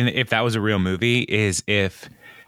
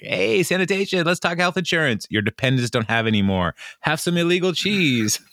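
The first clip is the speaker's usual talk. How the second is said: mock upbeat boss voice; 7 semitones higher than usual. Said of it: "he's like".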